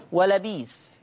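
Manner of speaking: "ولبيس" as wa-la-biis; smooth long ii in "ولبيس"; no glottal stop in "ولبيس"; hamza turned to yaa. Shown as a demonstration. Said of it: "بإبدال الهمز الساكن".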